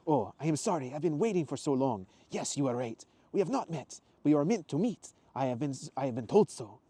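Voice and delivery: put-on apologetic, emphatic Italian accent. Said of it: "his faded Italian accent".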